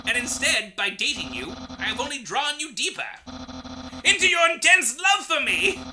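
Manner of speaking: loud, high, boastful skeleton chef; theatrical bragging skeleton shouting; Papyrus voice. Acted. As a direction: boom it out like a proud cartoon skeleton.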